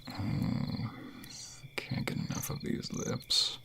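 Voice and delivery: breathy, very soft, slowing down; intimate whisper. Acted. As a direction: murmur between kisses. Kissing his lover.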